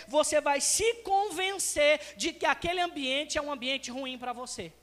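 ordinary talk, nothing marked

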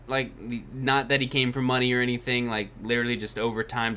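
ordinary talk

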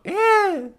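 high-pitched, loud imitated screech; shrieked "eh!". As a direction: squawk it like a strange animal's mating call. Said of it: "Con este tono". (chillido agudo de animal)

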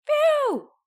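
very high, loud phew; exaggerated high relief; relieved exclamation. A narrator's reading. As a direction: let out a big storybook sigh of relief. Villains escape to their van.

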